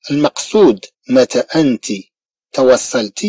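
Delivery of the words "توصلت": ordinary talk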